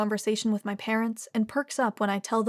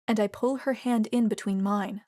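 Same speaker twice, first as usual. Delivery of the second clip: plain reading voice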